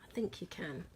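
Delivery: soft unvoiced whisper. Real, not acted. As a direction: whisper it to yourself. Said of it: "I'm whispering".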